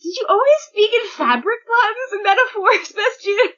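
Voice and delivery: high, amused teasing; laughing tease. Real, not acted. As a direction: laugh through the question. Teasing a character.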